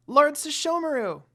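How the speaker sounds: put-on high, loud little girl's call; child calling out. A narrator's reading. Rin running toward her lord, calling loudly. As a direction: call his name like a small girl running up. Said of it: "A voice called out his name".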